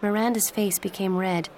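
ordinary delivery

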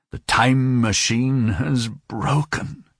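slow, drawn-out lament; understated near-sob, stretched out; almost sobbing. A narrator's reading. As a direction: grieve it slowly, on the edge of a sob. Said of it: "he almost sobbed".

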